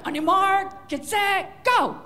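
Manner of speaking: loud, rising starter's call; race start shout. Pretend. Owner calling the start at a cat agility contest. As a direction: fire off the start like a race official.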